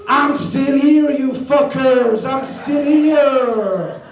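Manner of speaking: put-on high, drawn-out crowing in a French accent; slow, high-pitched French-accented gloat; defiant taunt. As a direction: crow it triumphantly, stretching each word. French persona taunts Brexit supporters.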